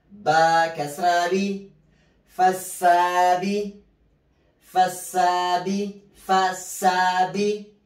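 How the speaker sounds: slow chanted tajweed recitation, spelling each letter; Quran recitation drill. Shown as a demonstration. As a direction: chant the letters and the word for students to repeat. Teacher chants spelling and Quran words. (ترتيل تعليمي بطيء مع تهجئة الحروف)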